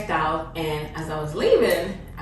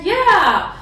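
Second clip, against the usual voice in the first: high, eager coworker chatter; gushing bartender; 8 semitones higher, 4 dB louder than usual. Retold or imitated. Bartender gushes to guest about coworker Oscar, high and eager. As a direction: gush about a coworker you adore.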